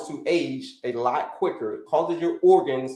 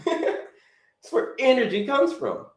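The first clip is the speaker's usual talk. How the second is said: slow, higher line through a laugh; 10 semitones higher, slower than usual. Real, not acted. Coach telling viewers why they eat, laughing. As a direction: laugh as you state the obvious. Laughs while repeating his point.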